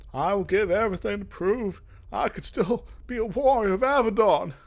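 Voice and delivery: put-on the young guard's vow swelling to a proud cry; fervent vow. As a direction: swear it with everything you have. Passionate vow to prove himself.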